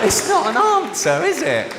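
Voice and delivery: high mocking question. Real, not acted. Comic rhetorical jab.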